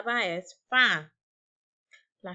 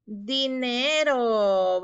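"Dinero" drawn out very slowly and louder; stretched slowly; 3 semitones higher, 4 dB louder, much slower than usual. Shown as a demonstration. Teacher reveals the word "Dinero" to pupils, very slowly. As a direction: stretch "Dinero" long and clear. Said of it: "¿Cuál es la palabra?".

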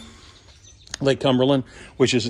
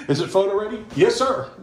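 laughing; 8 semitones higher than usual. Real, not acted. Joking with Josh about photo.

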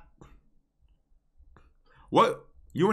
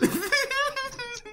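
very high wheezing laugh; high-pitched giggling wheeze; 7 dB quieter than usual. Real, not acted. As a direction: break into a squeaky, soft laugh. Laughs at own bad screenshot.

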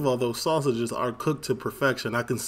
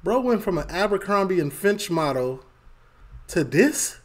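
surprised; 8 semitones higher, 3 dB louder than usual. Real, not acted. Reacting to a before and after.

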